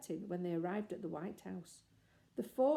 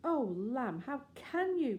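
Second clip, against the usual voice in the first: high, hurt reproach; reproachful cry; 5 semitones higher, 4 dB louder, slower than usual. Acted. Jane crying out at her lying brother, reproachful. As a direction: cry out at his lie. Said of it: "cried Jane".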